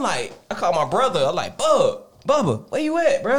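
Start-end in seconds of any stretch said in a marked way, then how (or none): 1.6-3.4 searching the house for Bubba, calling out. Retold or imitated. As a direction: call out for a brother hiding in the house.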